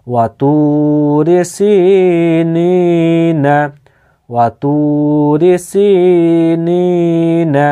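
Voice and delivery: slow reading with every long vowel held; slow, held Quran reading; drawn-out recitation. Shown as a demonstration. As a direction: stretch the long vowels of ওয়া তুরি সিনিনা. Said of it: "এখানে টানতে হবে".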